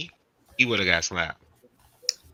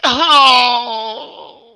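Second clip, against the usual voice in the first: loud, high mock cry; drawn-out hammed-up howl; imitated wail; 11 semitones higher, 8 dB louder than usual. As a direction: wail it out, imitating a distraught man. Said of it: "Frank like".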